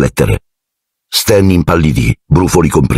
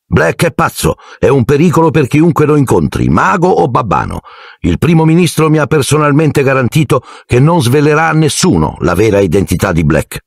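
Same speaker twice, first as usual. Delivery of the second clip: higher-pitched irked official; annoyed; 9 semitones higher than usual. A narrator's reading. Minister defending his decision to critics, mildly annoyed. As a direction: justify yourself, mildly annoyed and high.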